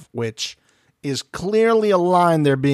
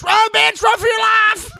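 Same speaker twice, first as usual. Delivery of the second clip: high frantic shriek, much louder; screaming warning; 4 dB louder than usual. Retold or imitated. Wayne Brady screaming at a woman by the car, high frantic shriek. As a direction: scream it like a panicked warning to run. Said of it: "Wayne Brady ... he goes".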